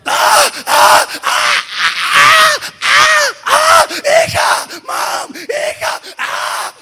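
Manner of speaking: very high, loud frantic screams; retold mother and daughter screaming; panicked screaming. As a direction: shriek while chasing the car. Mother chasing rolling car.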